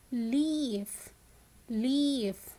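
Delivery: "leaf" with a stretched ee; slow phonics model of "leaf"; long E in leaf. Shown as a demonstration. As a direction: hold the vowel in "leaf". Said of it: "It is the e sound".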